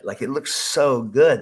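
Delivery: slow, drawn-out gush; slow, emphatic, loving repeat; gushing again. Real, not acted. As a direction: stretch it out, savoring it.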